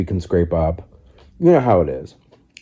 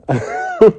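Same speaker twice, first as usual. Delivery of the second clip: laugh; 3 dB louder than usual. Real, not acted. Laughs at own joke.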